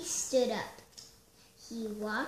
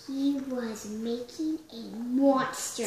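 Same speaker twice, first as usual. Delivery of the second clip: ordinary delivery, nothing marked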